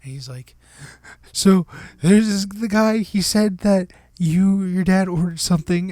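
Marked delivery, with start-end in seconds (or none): 1.3-5.9 high, halting, mock-anxious confession; shaking, near tears. Scammed employee explaining to his boss, shakily near tears. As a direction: confess haltingly, imitating someone about to cry. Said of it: "about to cry".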